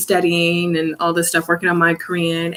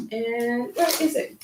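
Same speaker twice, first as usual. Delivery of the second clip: usual speaking voice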